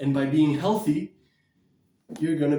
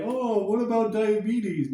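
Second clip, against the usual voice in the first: slow, higher, lightly mocking skeptic; 5 semitones higher than usual. Mimicking people who ask about diabetes, lightly mocking. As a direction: drawl out the doubter's question a bit higher. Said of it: "a lot of people say".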